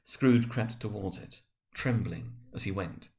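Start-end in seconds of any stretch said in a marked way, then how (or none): none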